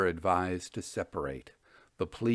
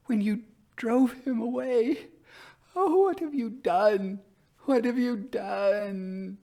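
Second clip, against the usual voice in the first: high, anguished reproach; wild grief; 12 semitones higher than usual. A narrator's reading. Elder sister wildly reproaches her sister for driving him away. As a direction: blame her sister, heartbroken and frantic. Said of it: "still very wildly".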